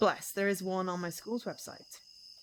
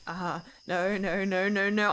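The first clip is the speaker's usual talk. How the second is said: panicked; 5 dB louder, slower than usual. Acted. Hears rustling in dark.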